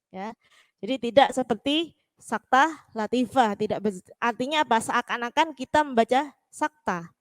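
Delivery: normal talking voice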